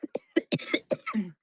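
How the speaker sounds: sharp high cough from dust. Real, not acted. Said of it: "The dust is killing me".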